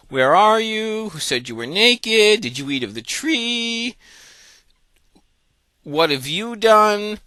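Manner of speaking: high-pitched, drawn-out baffled questions; bewildered, slow, high voice; surprised God. Acted. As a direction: stretch each question up high in mock astonishment. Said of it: "he's, he's surprised".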